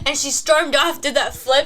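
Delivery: usual speaking voice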